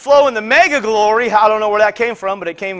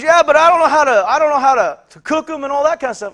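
higher-pitched, lightly acted objection; retold protest, pitched up; protesting man; 6 semitones higher, 5 dB louder than usual. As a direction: voice his helpless protest, starting loud. Acting out the man's protest.

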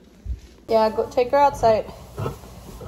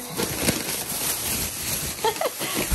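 breathless laugh; 12 semitones higher, 5 dB quieter than usual. Real, not acted. Laughing at the messy haul.

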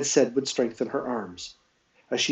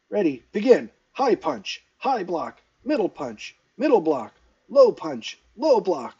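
higher-pitched, spirited drill commands; cheerful fighting instructor; 4 semitones higher, 3 dB louder than usual. A narrator's reading. Shang teacher drilling second years, commanding. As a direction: call out the drill to a class.

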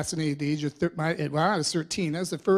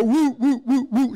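put-on high loud woo woo like barking kids; kids' dog-like woo; 8 semitones higher, 8 dB louder than usual. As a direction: hoot it like excited kids barking like dogs. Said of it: "barking like dogs".

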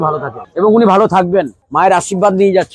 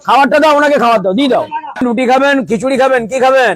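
higher and fast, hurried calling out over the bustle; busy hawker's quick raised call; calling out at the stall; 6 semitones higher than usual. Real, not acted. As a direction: call out to staff and customers fast and high. Busy stall owner calling out orders.

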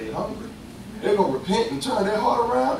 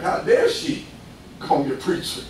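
attitude; 3 semitones lower, 3 dB quieter than usual. Retold or imitated. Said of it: "with an attitude".

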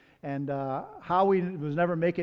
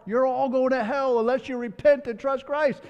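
higher, louder hellfire preaching; preaching in a bar; 7 semitones higher, 4 dB louder than usual. Retold or imitated. Howie preaching to drunks in a bar, loud hellfire warning. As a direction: call out the whole room to repent. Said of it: "start preaching in the bar".

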